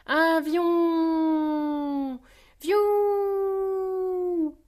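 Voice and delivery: high, long-held cries; excited toddler shout. A narrator's reading. George boarding the plane, excited shout. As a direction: squeal it out, stretching each ending. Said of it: "s'écrie George".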